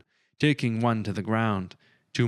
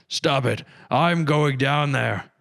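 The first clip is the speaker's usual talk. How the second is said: struggling shout; 5 semitones higher, 5 dB louder, slower than usual. A narrator's reading. Reginald dragged back, struggling.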